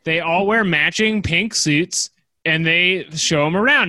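ordinary delivery

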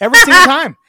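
high, very loud outburst; exasperated loud exclamation; emphatic exclamation. Real, not acted. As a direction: blurt it out at full volume. Exclaiming over own story.

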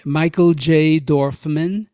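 ordinary talk, nothing marked

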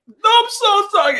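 high, whiny sorry voice; over-apologizing. Retold or imitated. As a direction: beg forgiveness dramatically. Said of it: "constantly apologizing".

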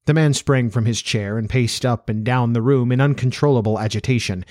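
usual speaking voice

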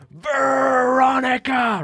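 drawn-out high shout of the name; teacher shouting a name. Retold or imitated. Teacher catching a student, slow high shout. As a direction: call her out, stretched and sharp. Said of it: "She just goes".

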